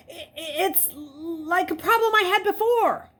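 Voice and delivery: high, loud flustered stammer; tripping over the words. A narrator's reading.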